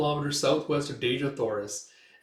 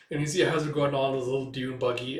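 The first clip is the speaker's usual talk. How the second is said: usual speaking voice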